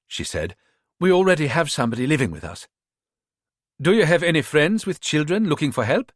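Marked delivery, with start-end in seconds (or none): 1.0-2.7 quick, lightly voiced posh English accent; politely turning her down, posh English. Mother declining the maid offer, quick posh English accent. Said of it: "She had a posh English accent". 3.8-6.1 put-on a light Ghanaian accent, pitched up; job-seeker with a Ghanaian lilt. Rita posing as a maid to the mother, Ghanaian accent. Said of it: "staying with the Ghanaian accent".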